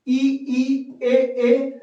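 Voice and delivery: ई and ए each said twice, clearly; vowel demo. Shown as a demonstration. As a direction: sound out ई, ई, ए, ए for the class. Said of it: "आप एक बार उच्चारण करके".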